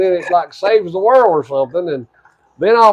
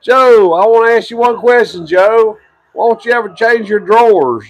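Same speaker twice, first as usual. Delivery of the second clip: heckling; 6 semitones higher, 5 dB louder than usual. Real, not acted. Heckling wrestler on TV.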